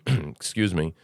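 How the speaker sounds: ordinary talk, nothing marked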